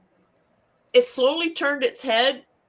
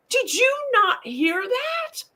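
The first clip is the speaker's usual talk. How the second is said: surprised; slower than usual. Retold or imitated. Shelley asks in disbelief.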